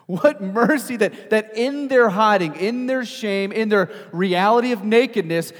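loud, high-pitched sermon delivery; impassioned preaching. Acted. Preacher telling congregation God comes after them, impassioned and loud. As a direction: preach it out with force, punching key words.

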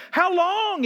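high, pushed cry of complaint; a psalmist's anguished call; mock psalmist yell. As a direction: cry out at God. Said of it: "He's yelling at".

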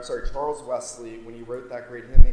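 ordinary talk

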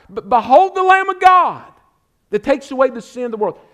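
high, ringing pulpit cry; full-force preaching. Real, not acted. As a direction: proclaim it loudly like a prophet, easing at the end. Preacher cries out John the Baptist's words.

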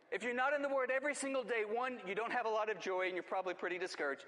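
high, loud opening that eases off; full-force preaching. Real, not acted. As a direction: drive the point home from the pulpit. Preaches the point over.

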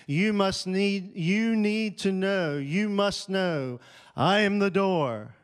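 full-force preaching crescendo; preaching climax. Acted. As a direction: lift it up and drive it home. Sermon climax quoting Jesus.

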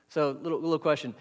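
usual speaking voice

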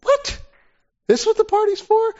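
high, squeaky outraged "What?"; shocked disbelief. Acted. Older brother hearing why the party is thrown, shrill shock. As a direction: leap up in pitch, stunned the party is for him. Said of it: "your brother's back".

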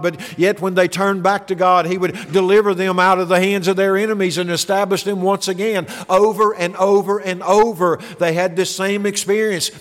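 usual speaking voice

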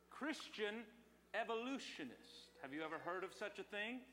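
ordinary talk

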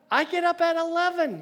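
loud, very high comic objection; indignant protest. Acted. Boy invited to join at six, indignant protest. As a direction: protest like an affronted kid. Acted protest at early meeting.

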